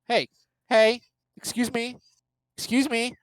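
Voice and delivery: high calling out. Retold or imitated. Interrupting the call, calling out to someone. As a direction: call across the room for attention.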